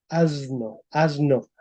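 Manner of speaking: "Asno, asno" with buzzing z before each n; voiced S pronunciation. Shown as a demonstration. Teacher repeats modern form for class, s in "asno" voiced as z. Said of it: "la S se transformó en una Z".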